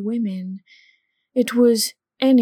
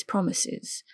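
softer whispered repeat of promises; whispered echo; 8 dB quieter than usual. A narrator's reading. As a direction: let the second promises fall to a whisper. Teasing comeback, said twice.

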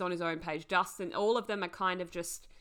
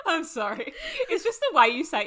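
higher, louder, giggly explanation; laughing apology; 10 semitones higher, 8 dB louder than usual. Real, not acted. Telling co-host why that phrase was funny, laughing. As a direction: explain through a fit of giggles.

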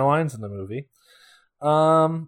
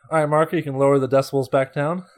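normal talking voice

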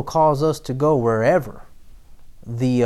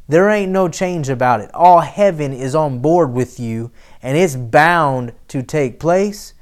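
louder preaching that drives each phrase home; full-force preaching; 6 dB louder than usual. Acted. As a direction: rouse the church and build to a shout.

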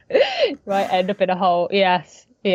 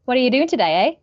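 Canadian eh. Retold or imitated. Actress imitating Canadians she has heard, lilting eh. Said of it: "some might go, some I've heard".

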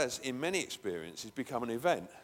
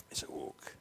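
"It's a walk." whispered; whispered echo; 9 dB quieter than usual. Shown as a demonstration. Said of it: "repeated as whisper".

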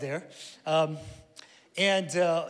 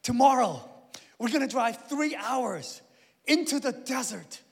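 bright, higher excited announcement; dad announcing a surprise trip, higher and eager; announcing to kids; 6 semitones higher, 5 dB louder than usual. Retold or imitated. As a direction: sell the trip to the kids with big enthusiasm. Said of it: "I'm like".

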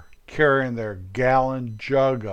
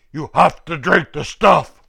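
bullying interrogator; 3 semitones higher, 6 dB louder than usual. Retold or imitated. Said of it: "the bad guys going".

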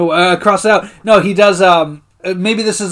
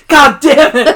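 loud, high mock curse; mock outburst; 8 semitones higher, 7 dB louder, slower than usual. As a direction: burst out in exaggerated frustration, half laughing. Acted outburst on seeing the camera.

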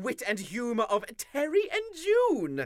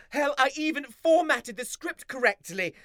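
ordinary delivery

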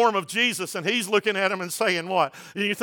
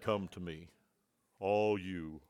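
much lower and softer, mock formal; formal preacher voice; 11 semitones lower, 11 dB quieter, slower than usual. Preacher parodying a formal preacher, low and solemn. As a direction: intone it gravely with highs and lows. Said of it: "maybe we need to be a little formal".

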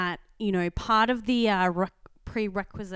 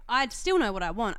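ordinary talk

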